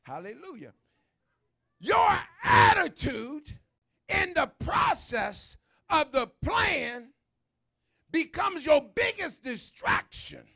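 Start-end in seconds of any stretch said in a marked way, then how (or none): 1.8-10.5 loud, slow preaching at full force; preaching shout. Real, not acted. Preacher shouting to the congregation about attitude. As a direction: drive the point home, loud and slow.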